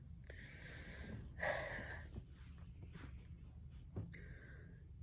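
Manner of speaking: long, soft, breath-only sigh. Real, not acted. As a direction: let out a drawn-out sigh after summing it up. Sums up feeling lonely and stuck.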